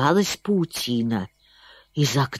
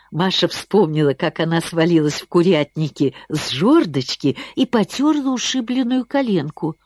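ordinary delivery, nothing marked

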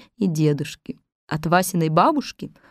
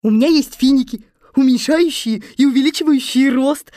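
higher, slightly louder helper voice; eager Little Muk; 7 semitones higher, 5 dB louder than usual. Acted. Little Muk to the captives, eager. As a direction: pipe up with an idea.

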